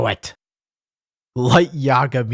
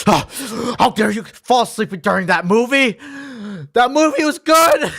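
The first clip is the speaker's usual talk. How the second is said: high, loud indignant teacher; hammed-up outraged scolding; offended teacher, building louder; mock teacher tirade; 7 semitones higher, 3 dB louder than usual. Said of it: "They're like, screaming at your face".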